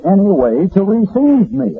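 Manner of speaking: high, slow, offended protest; mildly indignant retort, imitating the man; a hammed-up affronted visitor. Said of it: "he said indignantly".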